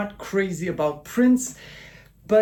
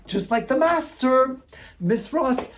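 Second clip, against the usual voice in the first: excited admiration; 7 semitones higher than usual. Real, not acted. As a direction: lift it up with fan excitement. Gushing over idol [name].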